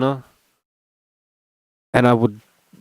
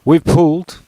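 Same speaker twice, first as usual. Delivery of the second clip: excited reveal; 6 semitones higher, 7 dB louder than usual. Real, not acted.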